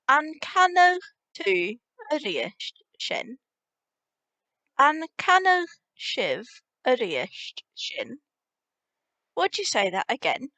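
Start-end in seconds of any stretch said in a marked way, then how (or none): 0.1-3.4 the same phrase repeated slowly for learners; slow demo. Shown as a demonstration. Teacher repeating a phrase slowly so learners catch it. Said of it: "you could say". 4.8-8.2 slowly repeated phrase for learners; slow demo. Shown as a demonstration. Teacher slowly repeating the polite form for learners. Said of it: "you could say".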